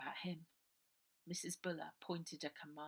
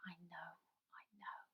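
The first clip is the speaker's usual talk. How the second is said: soft whisper, much quieter; 12 dB quieter than usual. A narrator's reading. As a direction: breathe it into her hair. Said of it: "and whispered".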